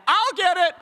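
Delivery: put-on high, loud eager child; eager kid. Kid eagerly volunteering for the phone at dinner, high and loud. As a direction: jump in to grab the phone. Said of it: "And I go".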